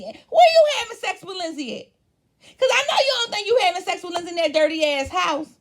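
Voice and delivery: high, loud disbelief, fast at the end; incredulous shout. Real, not acted. Mocking Scott to the viewers, a high, loud incredulous yell. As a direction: yell the question at him in disbelief.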